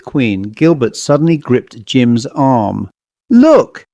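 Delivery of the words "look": high, loud cry of discovery. Acted. Gilbert cries out to Jim at the eighth queen, loud and high. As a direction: call his friend's eye to it with a sharp shout. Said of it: "he cried".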